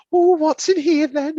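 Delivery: high coy mum voice; blushing mum. Retold or imitated. Teller voicing mum at the last present, high and coy. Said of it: "sort of blushing".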